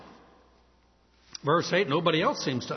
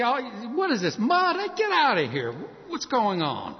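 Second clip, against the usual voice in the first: startled protest; 6 semitones higher than usual. Retold or imitated. Blind man feeling mud on his eyes, high startled protest. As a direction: jump at the feel of mud.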